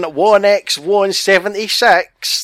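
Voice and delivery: slow, high, hammed-up Scottish accent; terrible Scottish accent. Reading out a code in a terrible Scottish accent. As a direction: drag the numbers out in a bad Scottish accent. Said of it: "That's my terrible Scottish accent there".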